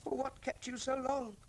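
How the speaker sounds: high, soft little creature voice; small Micket asking Father Christmas why he's late, high and soft. Acted. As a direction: squeak it like a tiny moon creature.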